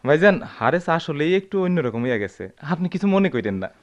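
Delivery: ordinary talk, nothing marked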